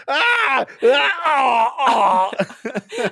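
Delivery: high, loud mock wails; mock distressed cries. Imitating a severed head crammed into a bag, comic wailing. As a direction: cry out as if being crammed in, playfully.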